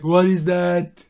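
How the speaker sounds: loud, slow, puzzled tough guy; action-star impression voice. Action star hears a didgeridoo, booming puzzlement. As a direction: boom it out slowly as an action hero. Imagined Schwarzenegger and Stallone scene.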